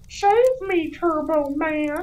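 very high squeaky plea, drawn out; kid crying for help. Retold or imitated. Kid hanging from the tree begging Turbo Man, squeaky plea. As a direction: whimper for rescue like a small boy. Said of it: "Anakin's just like".

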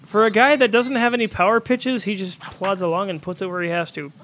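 ordinary talk

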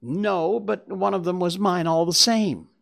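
high-pitched acted whine; whining. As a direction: protest as a cringing little man. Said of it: "whined the little man".

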